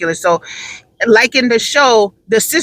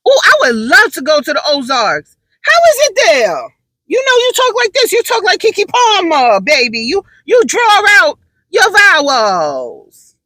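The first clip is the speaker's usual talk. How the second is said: put-on sister girl; 10 semitones higher, 3 dB louder than usual. Shown as a demonstration. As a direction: show off how a sister girl talks. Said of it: "that is sister girl".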